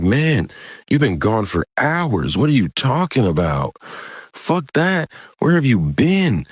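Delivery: high, swooping mock indignation; exasperated questioning. Campers confront the man who was gone for hours, exasperated. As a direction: demand answers in a high, incredulous voice. Retold angry questioning on return.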